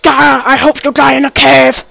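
very loud, rough roar of an angry giant; Hagrid. Acted. Gamer showing off an impression, loud Hagrid bellow. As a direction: bellow it like a big angry character. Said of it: "That's my Hagrid impression".

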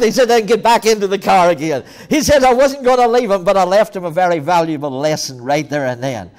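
loud, high, driving sermon storytelling; full-force preaching. Acted. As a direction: belt the story out to the congregation.

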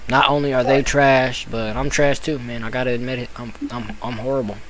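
dejected admission. Real, not acted. Admitting defeat after dying in game.

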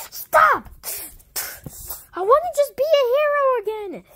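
high, fast shouted plea to stop. Acted. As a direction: yell it out like a desperate hero. Hero shouts in toy fight.

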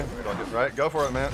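ordinary talk, nothing marked

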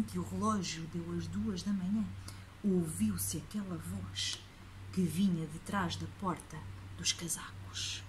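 low hushed whisper. A narrator's reading. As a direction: breathe it out with dread. Suspense before monster.

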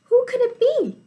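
plain reading voice

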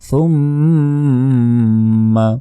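সুম্মা held long with nasal ghunna. Shown as a demonstration. Teacher shows the ghunna slowly. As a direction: stretch the mm in সুম্মা. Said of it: "সুমে এখানে গুণনা হবে".

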